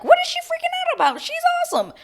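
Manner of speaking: very high, quick squeal of disbelief; mock bafflement. Retelling Maria's reaction to the drama, high-pitched mock bafflement. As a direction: pitch it way up, imitating someone incredulous.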